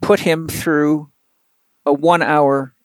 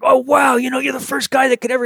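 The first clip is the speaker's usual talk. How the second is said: much higher, fast, gushing with delight; thrilled patient; 10 semitones higher than usual. Retold or imitated. Pro pitcher raving to his therapist, excited and high. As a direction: bubble over like a patient who finally got to work out. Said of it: "he was high as a kite".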